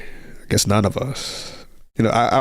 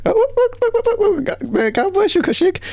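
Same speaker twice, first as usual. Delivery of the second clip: excited squeal. Real, not acted. Streamer thanks a viewer's gift.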